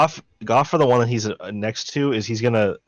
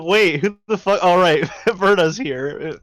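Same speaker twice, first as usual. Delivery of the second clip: surprised; 7 semitones higher, slower than usual. Acted. Player voices surprised reaction.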